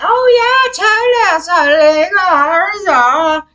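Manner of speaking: high sing-song mockery of the officials; airy mocking lilt, slow and high. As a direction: drag out "totally" in a sarcastic singsong.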